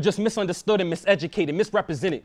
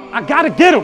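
rising intensity; 7 semitones higher, 8 dB louder than usual. Acted. Repeats line building up.

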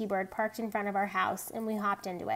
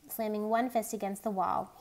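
usual speaking voice

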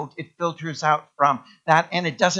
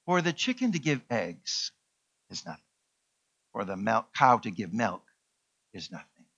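solemn pig; 5 semitones lower, 7 dB quieter than usual. Acted. Pastor voicing the pig giving its all, hushed and solemn. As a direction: let the pig weigh each word quietly. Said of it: "And the pig says".

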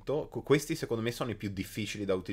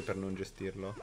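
ordinary talk

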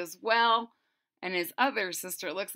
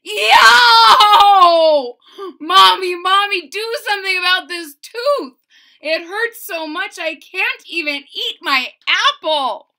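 high, loud pained yell; 9 semitones higher, 14 dB louder than usual. A narrator's reading. Boy yells to his mother about his aching tooth. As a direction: holler for Mommy about the tooth. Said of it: "and yelled".

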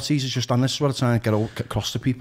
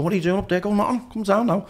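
higher-pitched imitation of calling upstairs; retold calling up to him, pitched up; acted domestic exchange; 9 semitones higher than usual. Acts out exchange with wife upstairs.

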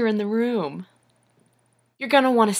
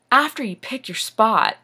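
normal talking voice

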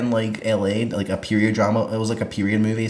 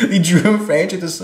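laughing; 9 semitones higher, 3 dB louder than usual. Real, not acted. Laughing while explaining.

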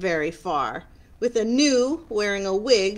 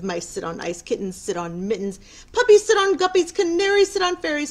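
cat listing rhymes; 5 semitones higher, 7 dB louder than usual. Acted. Cat rattles off rhyme list.

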